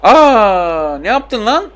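high, loud gasp of disbelief; shocked cry. Real, not acted. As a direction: yelp as if you can't believe your eyes. Shocked by his own play.

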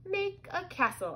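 high-pitched, deliberate order; commanding. A narrator's reading. Girl orders her wand, commanding. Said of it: "I commanded".

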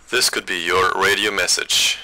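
crunchy distorted radio voice; like a cheap radio speaker. Shown as a demonstration. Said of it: "most radios sound a little bit distorted".

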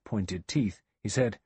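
plain reading voice

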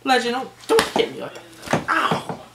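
high yelping plea to stop; mock protest in pain. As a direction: fend off a pretend beating with a yelp. Sidekick hits the host.

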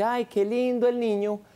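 high-pitched gushing admiration; cooing over baby. Acted. Visitor admiring a stranger's baby, cooing. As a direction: coo over someone's little one.

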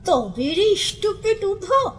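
drawn-out, high-pitched angry challenge; teeth-gritting threat. A narrator's reading. Budho lifting his bundle at Udho, angry drawn-out threat. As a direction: stretch it out through gritted teeth. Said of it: "দাঁত কড়মড় করে বলল".

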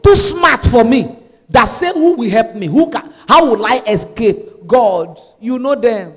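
preacher leading a prayer, drawn-out words, punched phrases; forceful prayer. Shown as a demonstration. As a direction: pray it out slowly and forcefully for them to repeat. Leads congregation in a prayer.

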